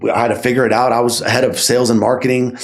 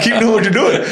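quoted much higher through a laugh; laughing mock boss; 12 semitones higher than usual. Retelling boss's praise to host, laughing high. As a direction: squeal it out laughing in disbelief.

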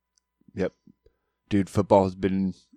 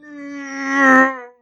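high burst of laughter; cracking up at himself; loud laugh; 8 dB louder than usual. Real, not acted. Laughs after his doppler demo.

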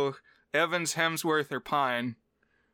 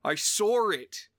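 put-on drawn-out, higher English accent with intrusive R; 6 semitones higher, slower than usual. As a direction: put an R after "saw", English style. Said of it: "sometimes in English accents".

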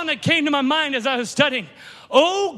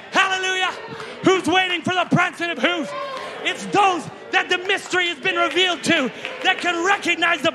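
fiery preaching; 4 semitones higher than usual. Acted. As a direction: stir the congregation up. Full-force preaching.

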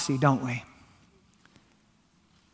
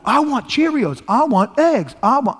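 lightly imitated kids, pitched up; higher-pitched mock kid demands; demanding kids; 7 semitones higher than usual. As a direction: mimic children placing breakfast orders. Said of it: "they're kids and they're going".

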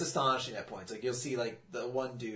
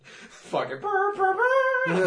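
high sung brr brr imitating a horn; mock horn blast; 9 dB louder, much slower than usual. Joking about the race start, mimicking the horn. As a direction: belt out a silly horn noise.

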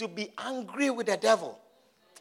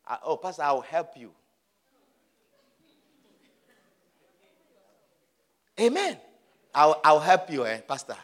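mock condescending favor; 6 semitones lower than usual. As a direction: pretend you are graciously doing someone a favor. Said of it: "it looks as if".

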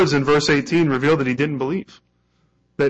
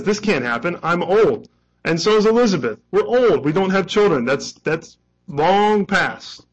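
higher and louder, building protest, slowing at the end; exasperated old man; 5 semitones higher, 4 dB louder than usual. Acted. Preacher voicing Zechariah's protest to the angel, exasperated and higher. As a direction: Zechariah pleading his age, voice climbing.

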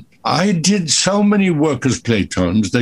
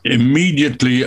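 ordinary talk

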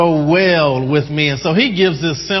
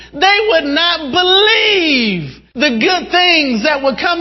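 high, impassioned voice of God; slow, stretched opening, then high and eager; excited exclamation; 8 semitones higher than usual. Acted. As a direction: exclaim with yearning, pitch held high. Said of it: "He has excitement here".

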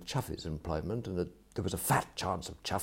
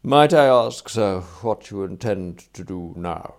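louder, formal butler's inquiry; deferential valet; 11 dB louder than usual. Acted. Valet asks his employer about future plans, deferential and formal. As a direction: ask with polite, careful formality.

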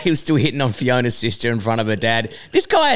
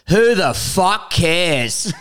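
slow, drawn-out dismissive barfly; old barfly; 3 semitones higher, much slower than usual. Retold or imitated. Old barfly scorning the comedian, slow and dismissive. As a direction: imitate an old pub regular, stretching each word. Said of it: "this old barfly turns around".

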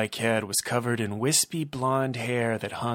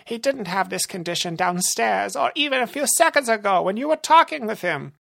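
higher, timid henchman; lieutenant obeys the boss, higher and meek; 10 semitones higher, 4 dB louder than usual. Acted. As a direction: answer like a cowed underling. Rumble protests.

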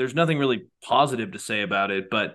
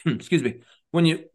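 coughing, choking on spit; 4 semitones higher than usual. Real, not acted. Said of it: "choking on my spit here".

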